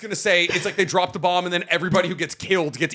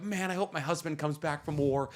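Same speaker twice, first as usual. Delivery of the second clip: soft sad voice; 3 semitones lower, 11 dB quieter than usual. Pretend. Acted villager line.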